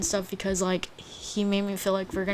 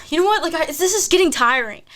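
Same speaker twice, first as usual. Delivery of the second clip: retold fed-up complaint, raised pitch; upset; 7 semitones higher than usual. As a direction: recount telling off a boyfriend, exasperated. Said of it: "I was so upset".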